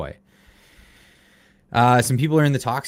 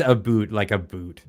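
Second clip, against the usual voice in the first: "a boot" for "about", Canadian style; mock Canadian vowel in "a boot"; imitated Canadian accent. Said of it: "he hams up the Canadian accent".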